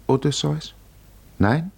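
ordinary delivery

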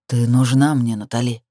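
lightly voiced man, low and assured; convinced. A narrator's reading. Lover tells the heroine he needs her, understated conviction. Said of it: "убеждённо сообщил он".

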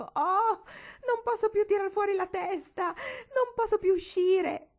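very high, loud panicked wail. A narrator's reading. Gwendolyn stuck in the gate wails in panic, very high and loud. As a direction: cry out stuck and scared, slow at the end. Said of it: "mandare un gemito fantastico".

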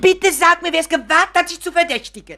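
high, loud protective demand; indignant plea. Acted. Mother insists Richard name his accuser, loud indignant plea. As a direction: demand the name, outraged for him.

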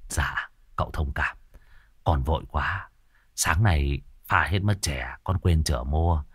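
soft, whispered apology; boy whispering an apology to his guest; sheepish whisper. Acted. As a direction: mumble an excuse under the breath.

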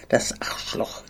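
a furious whispered hiss. A narrator's reading. Bloodied lieutenant hisses at the traitor, whispered. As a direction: hiss the curse under the breath.

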